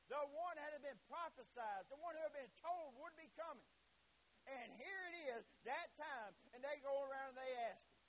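loud, high preacher's proclamation; impassioned preaching. Real, not acted. Preacher proclaiming the promised coming, impassioned.

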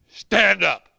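loud commanding call; commanding shout. Retold or imitated. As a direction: order him to his feet. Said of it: "He said".

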